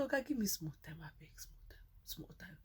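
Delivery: whispered plea, breath only; begging whisper. Retold or imitated. She begs the driver for a moment, whispering. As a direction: beg in a whisper. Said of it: "I said".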